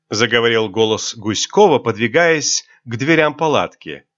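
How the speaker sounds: usual speaking voice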